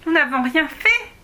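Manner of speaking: put-on a girl loudly denying any wrongdoing; protesting. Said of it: "protesta Alice".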